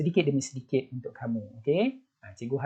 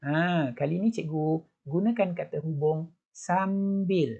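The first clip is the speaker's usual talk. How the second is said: ordinary talk, nothing marked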